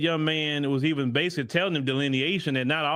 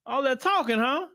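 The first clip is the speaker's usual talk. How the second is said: George Floyd waiting up there; 6 semitones higher than usual. Retold or imitated. George Floyd scolds Kirk for the podcast talk, high mocking voice. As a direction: needle him, pitched up high. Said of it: "They may be up there waiting for you and say".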